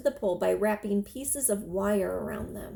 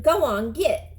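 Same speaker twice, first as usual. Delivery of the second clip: loud shout to scare a bird; boy shouts to shoo the crow, loud; 4 semitones higher, 7 dB louder than usual. Acted. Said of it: "the boy flapped his arms and shouted".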